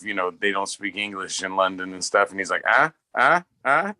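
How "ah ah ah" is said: higher, louder wordless "ah"s; pointing grunts. Retold or imitated. Friend pointing things out to London clerk, mimicked pointing grunts. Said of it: "like that".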